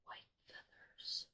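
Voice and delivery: slow hushed whisper; awed whisper. Real, not acted. Realizing the twist, whispering. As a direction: whisper as it dawns.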